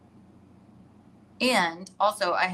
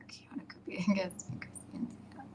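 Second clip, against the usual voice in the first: whispered reading; 11 dB quieter than usual. Real, not acted. Reading a comment under breath.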